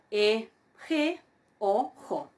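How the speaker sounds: "e" plain, "ge" with an initial h; each syllable set apart, aspiration on "ge" and "jo"; contrasting aspiration. Shown as a demonstration. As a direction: sound out "e, ge", then "o jo", alternating bare and aspirated. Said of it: "Lo mismo, bueno".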